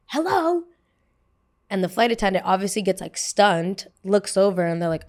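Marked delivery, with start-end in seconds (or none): 0.1-0.6 sharp high yelled hello; mock the rude passenger's scream; imitated scream. Passenger screaming at the flight attendant, high shrill yell. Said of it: "she then screams".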